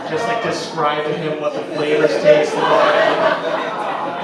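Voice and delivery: loud, slow, laughing words; cracking up while explaining; laughing loudly. Real, not acted.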